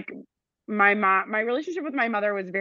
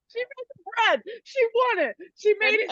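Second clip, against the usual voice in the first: tipsy, high-pitched giggly talk; 11 semitones higher than usual. Real, not acted. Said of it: "Sorry, I'm a little tipsy".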